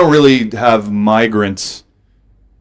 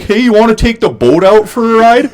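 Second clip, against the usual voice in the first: a cheery put-on Canadian voice; Canadian cousin; 12 semitones higher, 4 dB louder than usual. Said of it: "my cousin going like".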